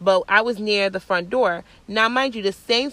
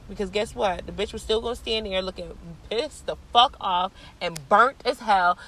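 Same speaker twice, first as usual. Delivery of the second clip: usual speaking voice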